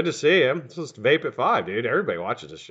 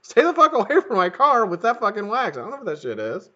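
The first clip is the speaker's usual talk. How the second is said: high-pitched indignant no; mock indignant refusal; 9 semitones higher than usual. Refusing the neighbor's car wax, high indignant outburst. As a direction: shoo him off, pitched up and outraged.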